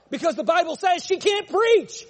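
high, loud preacher's shout; real pulpit outburst; emphatic shouting. Real, not acted. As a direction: thunder the answer at the congregation. Preacher raises voice in argument.

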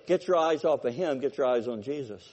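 normal talking voice

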